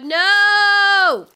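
long, loud drawn-out no; real shout of despair; anguished shout. Real, not acted. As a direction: wail at the screen. Creature attacks.

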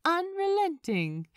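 plain reading voice

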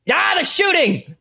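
high, alarmed yell; panicked cry. Acted. Game master as someone under fire, panicked yell. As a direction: cry out as shots come in. Acted villain reacting to gunfire.

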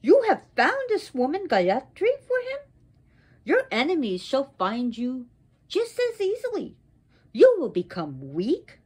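high, swooping pronouncements; wrathful curse. Acted. Goddess turns on Indra and dooms him, wrathful curse. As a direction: build the list to a loud, high peak.

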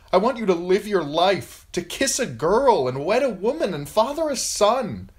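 impassioned noblewoman addressing lords; grieving mother begging for peace; pleading mother. Acted. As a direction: plead earnestly, rising high at the peak, then subsiding. Catelyn's speech in the story.

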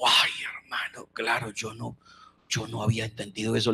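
breathy whisper, then quick; whispered realization. Retold or imitated. The pastor whispers his realization beside the Wailing Wall. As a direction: murmur the realization in awe.

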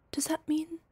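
breathless hope; hushed gasp. Acted. Realizing he might love her back, whispered. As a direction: gasp then high whispered question.

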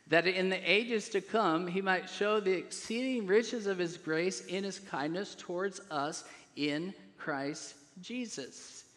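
ordinary talk

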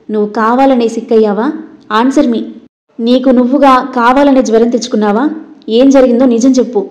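ordinary delivery

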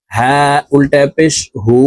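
"হু" held long with rounded lips; rounded, drawn-out vowel. Shown as a demonstration. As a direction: spell it out, then stretch "হু" for one alif. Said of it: "ঠোঁট গোল করে এক আলিফ টেনে". (ঠোঁট গোল করে "হু" এক আলিফ টেনে)

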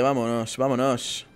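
usual speaking voice

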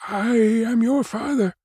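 high, slow emotional cry; high-pitched impassioned declaration. A narrator's reading. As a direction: cry it out, overcome, drawing out the words. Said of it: "cried he".

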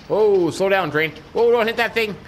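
high, urgent warning; alarmed. Pretend. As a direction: call out to brake the train. Train nearly hits something.

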